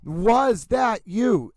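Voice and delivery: "was that you" very slow, WAS lifted; inflection on WAS. Shown as a demonstration. Host demonstrating the inflection, slow with lifted WAS. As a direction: stretch each word, raise WAS. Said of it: "It goes up".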